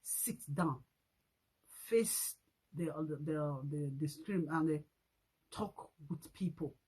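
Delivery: low, soft mock command; dismissive low order to the woman; mocking instruction. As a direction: mutter the order offhandedly. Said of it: "that just say".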